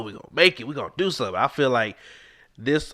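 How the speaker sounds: usual speaking voice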